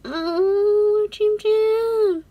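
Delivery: excited high fangirl gush. Real, not acted. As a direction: coo over him excitedly. Gushing over photo.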